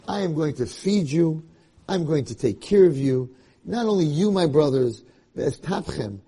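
ordinary talk

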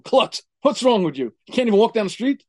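loud, high, mocking snap at him; scolding wife. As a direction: berate him with contempt. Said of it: "she says to him".

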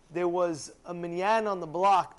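ordinary delivery, nothing marked

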